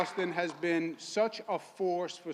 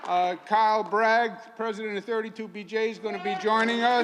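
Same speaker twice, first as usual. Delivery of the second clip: raised, projected announcer voice; rally introductions; 5 semitones higher, 6 dB louder than usual. Real, not acted. Host introducing community guests to the crowd, rally announcer. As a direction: announce each guest to the crowd, building to a loud finish.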